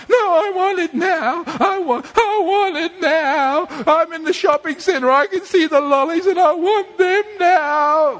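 put-on high, wailing child's demand; whinging child. Child demanding lollies in the shopping centre, mock whinging. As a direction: whine insistently like a child in a tantrum. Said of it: "a child who whinges continuously".

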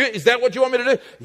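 ordinary delivery

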